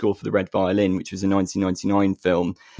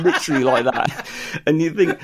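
usual speaking voice

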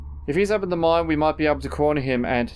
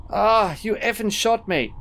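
high, slow, pained protest; wounded and outraged, pitch raised; pained outrage; 7 semitones higher, slower than usual. Acted. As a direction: cry out in hurt indignation. Character just hit by a bolt.

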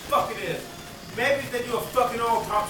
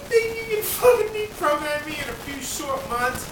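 high laugh-cry into a higher voice; mock them while half sobbing; laughing through tears; 12 semitones higher, 8 dB louder than usual. Real, not acted. Laugh-crying at therapists.

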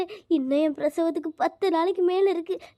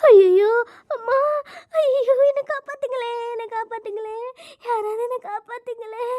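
crying scream for help; 7 semitones higher than usual. Acted. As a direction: scream in pain, then sob for rescue. Labour pain, crying for help. (வலியில் அலறி அழுது உதவி கேட்டல்)

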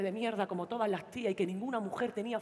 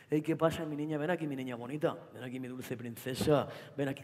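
put-on boyfriend; 9 semitones lower, 5 dB quieter than usual. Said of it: "novio Antonio abrazando".

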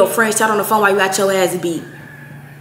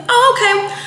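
high, mock-sweet oh okay; sarcastic oh okay; 12 semitones higher than usual. To the girl refusing to apologize, fake-sweet high dismissal. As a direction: brush her off with fake politeness.

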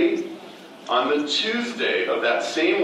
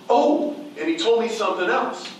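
higher, sudden afterthought; stranger turning back; 9 semitones higher than usual. Retold or imitated. A woman wheeling back to add one more message, higher.

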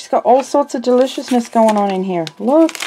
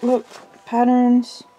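normal talking voice

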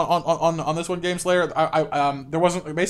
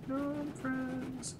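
high, very soft falsetto gnome friends; falsetto call; 9 semitones higher, 15 dB quieter than usual. Pretend. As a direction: coo it in a tiny high voice.

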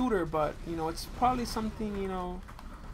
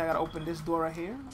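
ordinary delivery

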